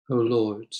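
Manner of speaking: ordinary talk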